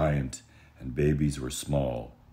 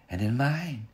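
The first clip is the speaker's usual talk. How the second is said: a mother bear's modest roar; middle-sized roar; 10 semitones higher than usual. Acted. Mama Bear agreeing with Papa, mild mock roar. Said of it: "said his wife in a middle-sized roar".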